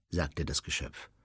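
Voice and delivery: plain reading voice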